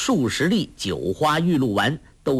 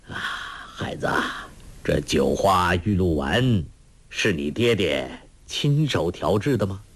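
kindly elder, labored speech; weakened old master; 6 semitones lower than usual. A narrator's reading.

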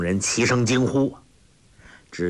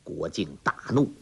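plain reading voice

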